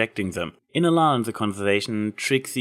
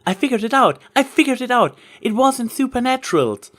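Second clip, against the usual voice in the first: much higher and louder, bursting with news; excited call; 7 dB louder than usual. A narrator's reading. Twilight running back to her friends with the answer, excited shout. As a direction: shout the discovery, thrilled. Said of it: "she called out".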